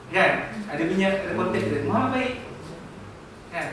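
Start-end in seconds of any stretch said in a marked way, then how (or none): 1.8-2.3 "Muhammad baik" lifted high, then falling; changed intonation. Shown as a demonstration. Teacher showing sentence intonation, "Muhammad baik" in a high arching tune. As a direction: raise the pitch right through "Muhammad baik". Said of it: "intonasi ayat tu".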